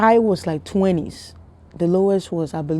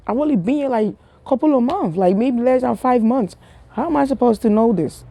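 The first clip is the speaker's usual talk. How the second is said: much higher, worked-up protest; indignant protest; 8 semitones higher than usual. Retold or imitated. Inner protest to mom.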